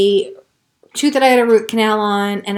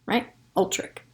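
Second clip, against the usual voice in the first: usual speaking voice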